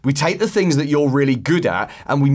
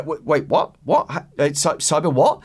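mock confusion; 4 semitones higher than usual. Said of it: "pause for a second and go".

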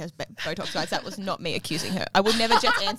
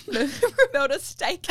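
laughing; 9 semitones higher, 6 dB louder, much slower than usual. Real, not acted. Laughing through her own rhyme.

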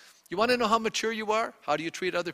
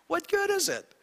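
exasperated question; 12 semitones higher than usual. Retold or imitated. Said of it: "He's going".

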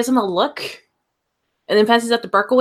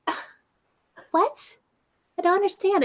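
higher, puzzled voice, softer; confused girl; 11 semitones higher, 4 dB quieter than usual. Acted. Carmen, confused by the note, asks in a high puzzled voice. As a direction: Carmen baffled by the note, asking what.

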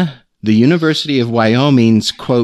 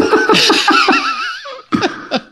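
laugh. Real, not acted. Host bursts out laughing.

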